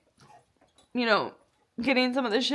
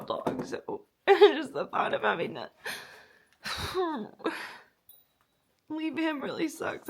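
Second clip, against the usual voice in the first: real quiet crying; tearful; 2 semitones higher, 4 dB quieter than usual. Real, not acted. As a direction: sigh and a broken laugh. Crying about rehoming dog.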